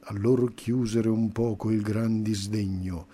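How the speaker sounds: plain reading voice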